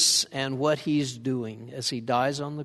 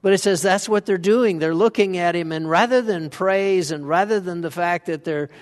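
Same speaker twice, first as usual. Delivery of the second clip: usual speaking voice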